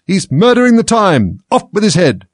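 high furious bellow, slow; bawling. A narrator's reading. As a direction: bellow it like an angry queen. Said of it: "the Queen bawled out".

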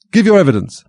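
high, loud royal order; commanding. A narrator's reading. King ordering the cook to testify, loud high command. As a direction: order it out loudly, pitched well up.

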